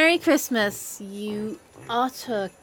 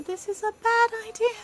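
high-pitched, distressed wail; squeaky, panicked regret; rising despair; 11 semitones higher than usual. Acted. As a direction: let the regret climb into a high whine. Regrets coming to Christmas market.

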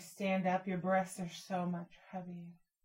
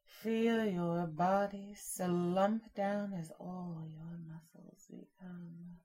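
ordinary talk

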